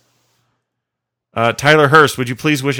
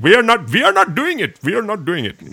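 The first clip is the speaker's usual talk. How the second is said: high-pitched, repetitive mock Indian accent; 10 semitones higher than usual. Host shows what he kept yelling in the car, mock Indian accent. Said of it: "broke out in this Indian voice".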